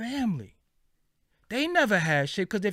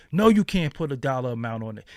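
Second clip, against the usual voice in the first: usual speaking voice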